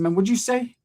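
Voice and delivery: a high, hard challenge; prison mode. Retold or imitated. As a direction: square up to him like a convict. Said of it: "I went straight to prison mode".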